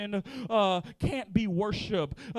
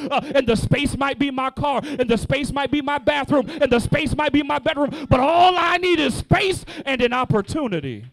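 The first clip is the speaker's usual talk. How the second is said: louder and louder, shouted climax; full-force preaching; 2 semitones higher, 8 dB louder than usual. Acted. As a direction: build each line to a shout.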